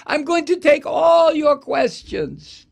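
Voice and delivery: high, loud hammed-up Long Island accent; mock Long Island schmoozer with a laugh; mock Scaramucci in a Long Island accent, high and loud. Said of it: "this accent from Long Island".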